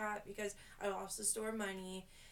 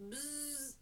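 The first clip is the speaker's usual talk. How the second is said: imitated buzz of hair clippers; 5 semitones higher, 6 dB quieter than usual. Stylist mimics switching on the clippers, short buzz.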